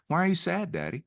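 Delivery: lightly voiced curious child, high start falling away; three-year-old son. Son asks his daddy about his sadness, lightly imitated child. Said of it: "he's three".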